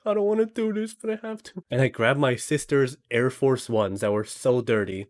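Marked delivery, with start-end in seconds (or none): 0.1-1.6 high, soft mock-tearful voice; imitated crying. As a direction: act out a reluctant, tearful confession. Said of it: "She's crying. She's like".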